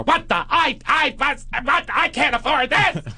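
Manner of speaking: high, sputtering queeny outburst; queeny hissy fit. Retold or imitated. Comic retelling of Duty's fit at the traffic stop, queeny hissy fit. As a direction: shriek it like a flustered queen. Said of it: "Queenie piss fit".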